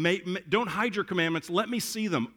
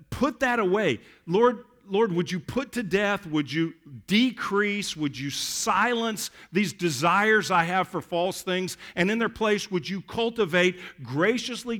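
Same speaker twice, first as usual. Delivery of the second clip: fervent prayer; 4 semitones higher, 4 dB louder than usual. Acted. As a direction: pray it out with fervour. Preaching prayer.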